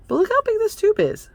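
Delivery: high, loud squeaky exclamation; excited squeal. Real, not acted. Vlogger showing viewers a big tube, excited squeal.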